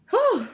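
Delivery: loud, high puffed "whew"; sharp high exhale of exertion; winded whew. Real, not acted. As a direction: let out a big breathless whew. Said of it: "I'm out of breath".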